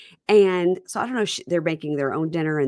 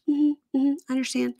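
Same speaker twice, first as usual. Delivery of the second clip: mock nodding; 9 semitones higher than usual. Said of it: "It's just".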